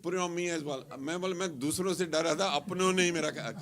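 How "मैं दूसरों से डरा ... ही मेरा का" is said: rushed Hindi lament; rapid complaint. Retold or imitated. Complaining his own people colored him, rapid rush. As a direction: rattle it off in a rush.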